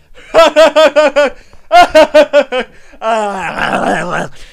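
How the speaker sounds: high, loud hammed-up cackling laugh; mock his giddy laugh, high and loud; wild mock laugh. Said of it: "And he's like".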